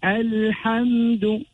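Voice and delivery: Alhamdu slow, with a distinct l before the h; clear lam. Shown as a demonstration. Teacher showing the clear lam, Alhamdu slow and distinct. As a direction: say Alhamdu slowly, sounding the l clearly. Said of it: "will be read clearly".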